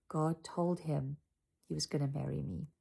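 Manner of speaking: usual speaking voice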